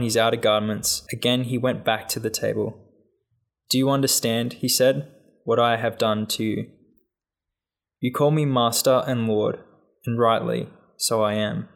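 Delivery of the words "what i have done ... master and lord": usual speaking voice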